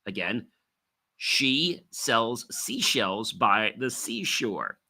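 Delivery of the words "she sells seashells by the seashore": slow tongue twister, each word separated; deliberate articulation drill; slow and clear. Shown as a demonstration. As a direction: enunciate every word carefully, unhurried. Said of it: "Speak them slowly and clearly".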